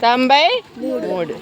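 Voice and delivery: slow, deliberate reading of the number; slow reveal. Shown as a demonstration. Teacher showing the paper to the class, slow reveal. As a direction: read the number out slowly as you show it. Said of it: "నేను మీకు ఇప్పుడు చూపిస్తాను".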